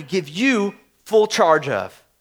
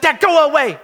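higher mock-exasperated kid; child's indignant dismissal, pitched up; imitating four-year-old; 6 semitones higher than usual. Daughter catching dad watching, mock-indignant. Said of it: "and she's like".

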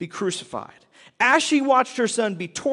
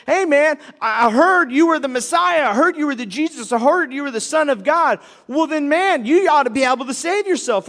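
higher, louder mocking hustler; taunting con man; 6 semitones higher, 3 dB louder than usual. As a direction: goad him like a smug con man. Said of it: "He's poking the bear and he's going".

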